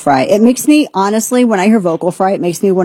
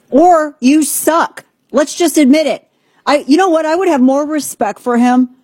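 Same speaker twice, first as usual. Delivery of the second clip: sarcastic jab; 7 semitones higher than usual. Acted. Host mocking Biden's debate excuse. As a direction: drag out the insult.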